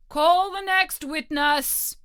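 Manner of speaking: slow, loud royal summons; a king calling the court to order; king's voice. A narrator's reading. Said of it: "said the king".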